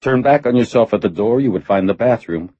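plain reading voice

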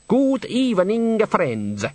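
put-on slow, high Italian-style accent; put-on foreign accent. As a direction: greet grandly in a thick foreign accent. Bather keeps up foreign accent.

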